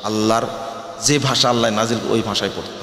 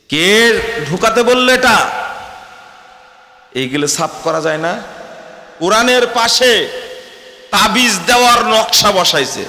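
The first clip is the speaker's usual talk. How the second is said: high, loud, fiery sermon peak; preaching at full force; 7 semitones higher, 4 dB louder than usual. Acted. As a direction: thunder it out to the congregation. (উচ্চস্বরে জোরালো ওয়াজ)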